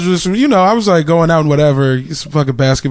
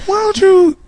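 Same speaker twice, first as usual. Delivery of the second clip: mom voice. Retold or imitated. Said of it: "she's like".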